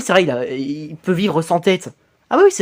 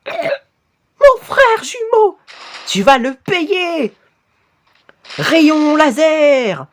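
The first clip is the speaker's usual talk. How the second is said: slow high revenge shout; 8 semitones higher, much slower than usual. Acted. Character vows revenge, fires laser.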